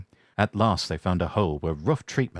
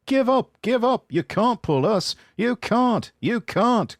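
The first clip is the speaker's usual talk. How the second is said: very high, loud taunting chant; taunting trucks; 3 dB louder than usual. Acted. Trucks mocking James on the hill, high taunt. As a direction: jeer it high like naughty trucks. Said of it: "called the trucks".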